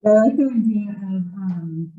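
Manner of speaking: muffled, as if behind a hand. Real, not acted. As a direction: speak muffled through a covering hand. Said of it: "Sorry, it's muffled".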